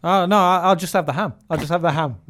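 loud, high-pitched mock protest; insistent customer. Customer telling barman he only wants ham, insistent.

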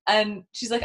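ordinary delivery

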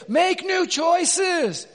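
slow, high, shouted command; shouted retort. Real, not acted. Pastor retorting to the angry man, slow shout. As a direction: bark it out to snap him awake. Preacher's retort in retold talk.

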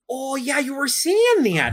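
very high, chirpy mom; bright folksy Midwest mom. Acted. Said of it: "I gotta get out of this Midwest Mom voice".

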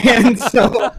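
high-pitched laugh spilling into the words. Real, not acted. Guest telling audition mishap to hosts, laughing. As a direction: talk through your own laughter.

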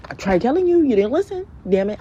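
high, loud exasperated telling-off; mock parental scolding. Parent scolding a child, acted.

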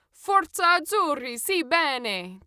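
loud, high fan's rallying cry; delighted to share the chant; happy cheer. A narrator's reading. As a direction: ticket checker happily echoes the Italian chant. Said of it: "ticket checker replied happily".

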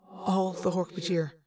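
grave, even statement with reverb; understated, measured alien character; echoing alien voice. A narrator's reading. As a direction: report it soberly as an echoing mind-voice.

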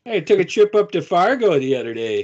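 hammed-up Fargo accent; lilting mock North Dakota voice; Upper Midwest accent. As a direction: chat like a Minnesotan. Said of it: "North Dakota, Minnesota, and Southern Canada".

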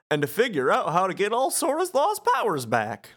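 high, swooping goofball voice; Goofy. Acted. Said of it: "Goofy says".

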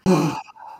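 long weary sigh; heavy sigh. Acted. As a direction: sigh like a tired official. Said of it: "gets this heavy sigh".

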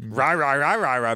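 garbled lipless rah-rah; lipless Red Skull. Pretend. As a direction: mumble without moving the lips. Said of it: "he doesn't have any lips".

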